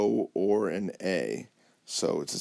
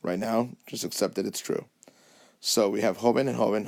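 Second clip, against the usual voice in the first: normal talking voice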